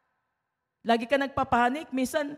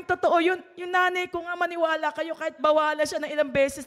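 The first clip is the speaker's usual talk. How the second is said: normal talking voice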